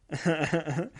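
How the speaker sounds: short, light run of chuckles; amused chuckle. Real, not acted. Host amused by a robber's Ferrari blunder, amused chuckle. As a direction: chuckle softly to yourself.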